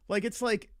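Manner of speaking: normal talking voice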